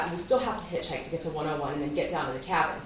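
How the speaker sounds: normal talking voice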